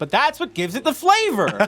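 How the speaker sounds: high, whiny protest; indignant cook. Acted. Cook defending his tartar sauce to the sheriff, high whiny protest. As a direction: defend the recipe in a high put-upon whine. GM voices diner cook retort.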